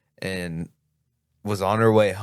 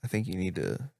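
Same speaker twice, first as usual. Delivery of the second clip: soft, halting mock therapist; quieter, with long unsure pauses; hesitant therapist; 7 dB quieter than usual. Acting a future AI-reliant therapist.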